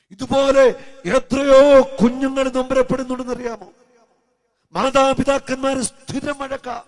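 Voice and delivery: loud, high, slow pulpit cry; a slow, loud sermon call; preaching at full force. Acted. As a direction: drive each duty home to the congregation. Appeal to congregation.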